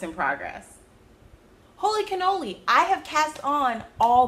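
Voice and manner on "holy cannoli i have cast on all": surprised. Real, not acted. Amazed at own cast-ons.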